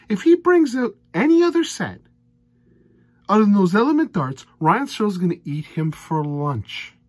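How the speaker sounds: loud, high, exasperated rant at the player; fed-up darts fan ranting loudly. Real, not acted. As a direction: vent in disbelief, almost yelling. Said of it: "Sorry for my rants".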